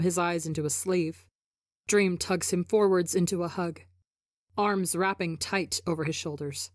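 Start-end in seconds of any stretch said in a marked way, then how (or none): none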